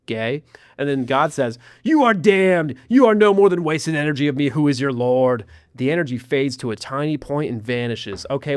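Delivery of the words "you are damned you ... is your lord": louder, higher voice of judgement; a lightly voiced god passing sentence; condemning god. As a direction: pronounce doom as the Lord.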